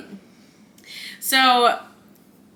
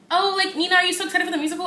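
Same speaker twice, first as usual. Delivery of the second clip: high, bright-pitched imitating of chirpy excitement; mock-chirpy question to the boss; mock cheerful; 8 semitones higher, faster than usual. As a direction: put on a peppy coworker voice. Retelling cheerful coworker.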